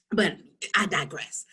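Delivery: under-the-breath aside; sly whispered aside. Real, not acted. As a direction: whisper it to the audience.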